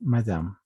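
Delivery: madame ending on the M; silent final E. Shown as a demonstration. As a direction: say madame with no vowel after the M. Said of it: "La E no se pronuncia". (madame con la E final muda)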